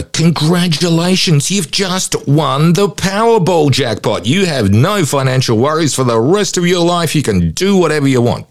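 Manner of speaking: high, bright mock prize announcement; game show announcer. Psychologist imitating a host announcing a jackpot, showy announcer voice.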